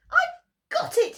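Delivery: high, squeaky little mouse; tiny excited mouse; a high mouse voice with a spark of an idea. A narrator's reading. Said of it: "he said".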